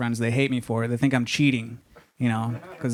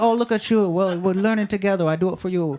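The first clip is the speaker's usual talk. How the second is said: put-on higher caricature Chinese accent; mock Chinese accent; 5 semitones higher than usual. Counter lady replies to his Mandarin, mock Chinese accent. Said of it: "She'll be like".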